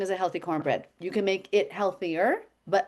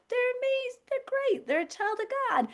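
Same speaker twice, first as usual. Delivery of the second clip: very high, sing-song gushing praise; overly sweet mock compliments; sugary praise; 4 dB quieter than usual. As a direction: gush over them sweetly. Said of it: "I'll be like".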